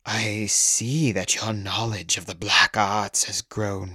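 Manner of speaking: slow, patronizing vampire drawl. A narrator's reading. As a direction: talk down to him slowly. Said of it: "spoke in a slow, patronizing tone".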